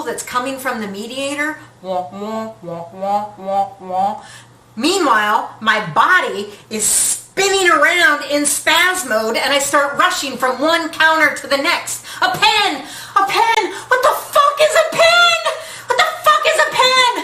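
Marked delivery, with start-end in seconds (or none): 1.8-4.2 low muffled wah-wah trumpet voice; Charlie Brown teacher jumble. Retold or imitated. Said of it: "the Charlie Brown teacher jumble". 12.2-17.1 high, loud panicked cries; frantic yelling. Real, not acted. Frantic search for a pen.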